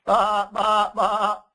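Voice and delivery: high, loud sung baa baa baa; sheep bleat. Acted. Parent reading the sheep page, high sung bleating. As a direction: sing the bleat like a lamb. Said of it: "Beat the woolly sheep".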